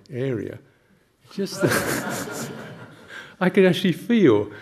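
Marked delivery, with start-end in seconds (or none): 1.3-4.5 laughing. Real, not acted. Laughing while telling story.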